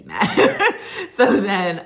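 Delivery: host giggles at the drama; laughing. Real, not acted. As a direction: talk through a laugh.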